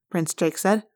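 ordinary delivery, nothing marked

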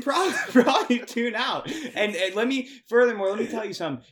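loud, high laughing talk. Real, not acted. Hosts cracking up over Naruto.

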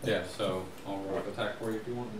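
normal talking voice

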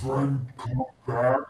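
slow creature voice, rising at the end; pleading creature. Acted. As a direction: plead like a big simple beast. Monster pleads with departing player.

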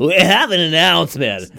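heaved-out WE, ballpark announcer imitation; retching announcer. Copying co-host's announcer demo, retching announcer voice. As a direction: nearly throw up on the word WE. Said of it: "gonna throw up on we".

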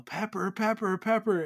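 high frantic yelling of the cat's name; panicked yell of the cat's name; mock scream. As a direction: shout the name in alarm. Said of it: "hearing Crystal scream bloody murder".

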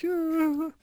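high, small mock whimper; a tiny forlorn squeak; sad mom. Mom just looking sad, high little whimper.

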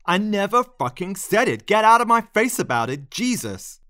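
loud, high, furious outburst; exploding. A narrator's reading. Lionel turns on his pleading admirer, exploding in anger. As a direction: explode in exasperated rage, voice shooting up. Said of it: "he exploded".

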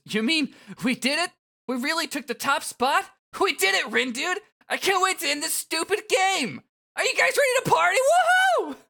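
very high, loud whooping; overjoyed celebration. Acted. Fret celebrating victory with the team, overjoyed. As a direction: build to an ecstatic high cheer.

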